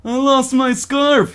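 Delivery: a lost, pitiful stranger on the train. Acted. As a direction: sound pitiful over the lost scarf. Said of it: "he says in a pitiful voice".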